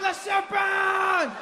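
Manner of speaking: put-on Le serpent stretched slowly, high and shouted in a French accent; slow, wailing French-accented alarm; drawn-out French cry. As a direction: hold the scream of "Le serpent" long. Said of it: "The French guy was my favorite".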